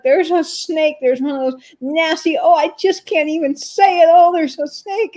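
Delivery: high, flustered panic voice; high-pitched, horrified babble; imitating terrified neighbor. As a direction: act out an elderly neighbor panicking. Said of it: "like, terrified".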